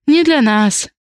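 lifted female character's voice, trailing down; echoing. A narrator's reading. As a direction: repeat it like an echo. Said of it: "эхом повторила Ри".

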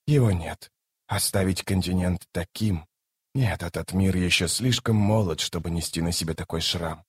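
soft, low, grave reasoning; grave god. A narrator's reading. Krul refuses to leave the land scarred, softly grave. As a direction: explain it quietly, with solemn finality.

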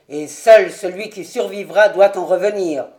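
a loud clipped one-word reply; grim loud answer. Acted. Colonel confirming they will meet alone, grim and loud. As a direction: bite off the word.

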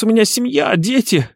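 high, desperate bleating appeal; pitiful high-pitched whine; bleating plea. A narrator's reading. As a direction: plead for mercy in a bleat. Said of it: "до тошноты противный блеющий голос".

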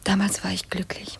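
whispered, nostalgic memory; wistful whisper. Acted. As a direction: let the memory come out on a breath. Wistful memory of happier times.

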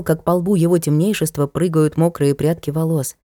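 ordinary delivery

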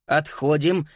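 ordinary delivery, nothing marked

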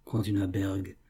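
usual speaking voice

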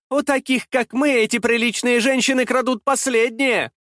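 very high-pitched, bitter tirade; mock the lady with furious envy; shrill, scornful outburst; angry jeering. A narrator's reading. Accuser's jeering tirade at her rival.

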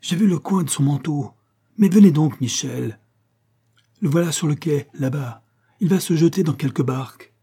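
high, excited chase calls; crying out. A narrator's reading. Cavalier chasing the shooter with Michel, crying out urgently. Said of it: "cria le cavalier".

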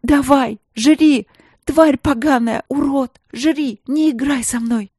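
high angry screaming; furious desperate yelling. Acted. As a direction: hurl abuse at the beast. Princess screams at bear.

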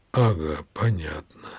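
lightly distracted, low and trailing off; low, preoccupied acknowledgement; absent-minded. A narrator's reading. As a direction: acknowledge it with your mind elsewhere. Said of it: "явно думая о чём-то другом".

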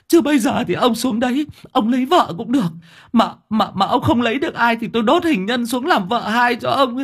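high-pitched, wailing, stammering plea; shrieking. Acted. Wife begs dead husband to release her, shrieking. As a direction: plead hysterically at the top of the voice's range. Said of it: "Bà rú lên".

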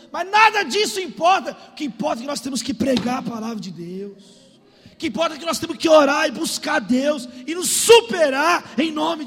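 high, loud rallying exhortation; full-force preaching. Real, not acted. Pastor rallying church to fight with God, forceful preaching. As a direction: push past tiredness and rouse them.

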